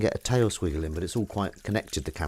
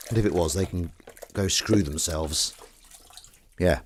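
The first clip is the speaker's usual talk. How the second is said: ordinary delivery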